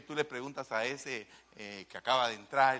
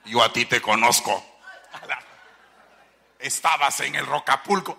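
loud, lowered voice of an accusing demon; 7 dB louder than usual. Acted. Demon accusing the exorcist, acted.

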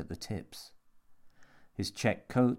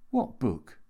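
baffled, startled query; confused question; 3 semitones higher than usual. A narrator's reading. Jimmy has forgotten the book, baffled. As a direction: ask it with real bewilderment.

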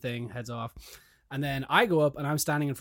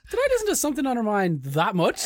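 high, louder, amazed question; surprised; 9 semitones higher, 6 dB louder than usual. Retold or imitated. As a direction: ask in mock disbelief. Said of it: "I was like".

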